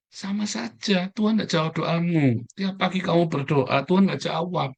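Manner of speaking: high, slow, hammering each word; emphatic preaching. Real, not acted. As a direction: drive the point home to the congregation.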